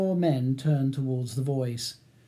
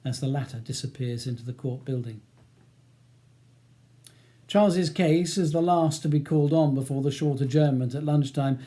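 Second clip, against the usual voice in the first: usual speaking voice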